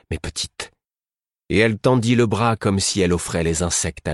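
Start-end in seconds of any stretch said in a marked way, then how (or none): 0.1-0.7 breathed in a whisper to the bees; whispered softly; a hushed whisper to the swarm; murmured. A narrator's reading.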